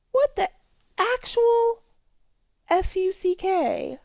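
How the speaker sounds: high, slow, spelled-out outrage; shocked disbelief. Real, not acted. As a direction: spell out each letter in disbelief. Shocked by a weird painting.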